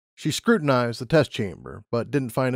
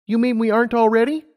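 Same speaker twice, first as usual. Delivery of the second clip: put-on high, eager young mare; Lumina's voice; 11 semitones higher, 4 dB louder than usual. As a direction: voice her up high, keen and bright-eyed. Lumina teases.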